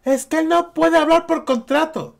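high mock voice parroting the youtubers. Vlogger imitating youtubers' excuse, high mocking voice. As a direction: ape the excuse in a high silly tone. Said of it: "Y todos los youtubers y la gente".

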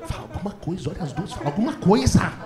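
soft hissed urging to the husband; wife urging him under her breath; urgent whisper. Retold or imitated. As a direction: whisper it through your teeth. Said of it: "Minha mulher em pé".

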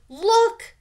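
high, loud shriek. A narrator's reading. Karen showing the damage to the girls, shrieking. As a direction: shriek it in alarm. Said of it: "shrieked Karen".

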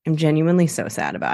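usual speaking voice

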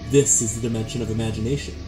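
ordinary delivery

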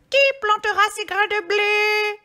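high, loud squawky hen; little hen crying out. A narrator's reading. Red hen asking who will plant the wheat, high crying-out voice. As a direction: call out as an excited little hen. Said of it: "s'écria la petite poule rousse".

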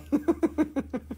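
high chuckle; laugh. Real, not acted. Seller laughing at his own crying routine, chuckling. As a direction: laugh in a quick, high run.

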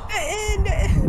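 high, loud urging shout; urgent yell. Real, not acted. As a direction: yell for them to hurry. Urgent callout.

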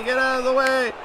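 high, full shout at the screen; frustrated shout, pitch way up. Real, not acted. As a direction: yell it at the player now. Repeated louder as a shout.

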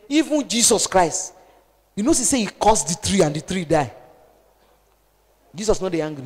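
ordinary delivery

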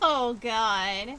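loud laughing exclamation; laughing oh god. Real, not acted. Overwhelmed by Gio's odd word picks, loud laughing. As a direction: cackle it out loud.

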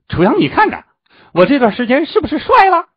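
very high, loud preening squawk; vain show-off. Acted. Vain disciple asks friend to admire him, excited boasting. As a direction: crow about your looks in a shrieky high voice.

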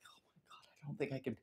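breathy whispered despair, almost no voice. Retold or imitated. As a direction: whisper it as if you can't go on. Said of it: "I was like".

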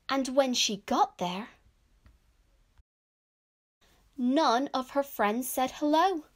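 loud, clear storybook reading; children's story narration. A narrator's reading. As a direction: read it out to young children, projected. Reads picture book aloud.

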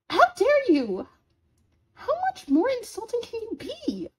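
high-pitched offended protest; outraged. Acted. Roommate insulted as built like a fireplace, outraged. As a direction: burst out affronted, pitch jumping up.

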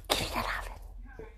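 soft whisper to a pet; whispered coaxing. Real, not acted. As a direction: whisper it sweetly to coax the dog. Coaxing the dog for affection.

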